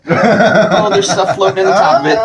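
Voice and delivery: laughing. Real, not acted. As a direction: laugh through the disgust. Grossed out by floating stuff.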